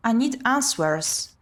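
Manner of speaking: ordinary talk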